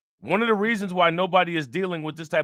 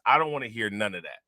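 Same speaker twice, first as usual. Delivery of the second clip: normal talking voice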